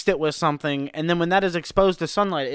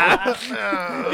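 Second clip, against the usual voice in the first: put-on baby cry; 4 semitones higher than usual. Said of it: "my best attempt at Austin's baby cry".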